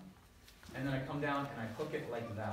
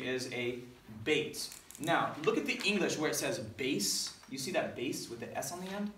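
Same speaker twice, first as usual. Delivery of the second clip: usual speaking voice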